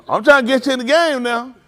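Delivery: ordinary talk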